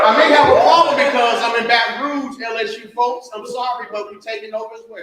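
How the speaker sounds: high, loud playful boast to the crowd; excited teasing. Real, not acted. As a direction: rile up the rival fans. Teasing LSU crowd, raised voice.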